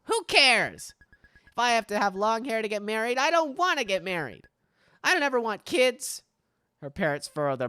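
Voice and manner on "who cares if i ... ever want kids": lightly voiced, a touch higher, rebellious girl; a hint of a sulky teenage daughter; defiant daughter. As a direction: toss it off like a stubborn kid. Streamer voices Min, defiant girl.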